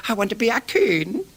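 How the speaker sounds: put-on high eager young voice; chirpy, earnest request; imitating the keen tall scout; quoting the scout. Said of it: "and he said".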